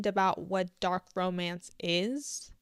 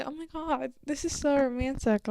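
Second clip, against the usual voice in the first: mock swooning; 4 semitones higher than usual. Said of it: "that's not like".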